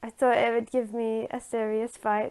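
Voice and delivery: usual speaking voice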